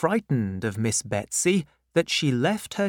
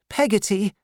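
put-on timid young widow; 9 semitones higher, 3 dB louder than usual. Mother answers.

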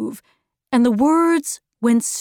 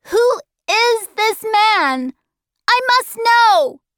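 put-on very high, forceful child's demand; insistent boy; 12 semitones higher, 4 dB louder than usual. Charles Wallace needing to know the man, high forceful boy. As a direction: build to a loud high insistence.

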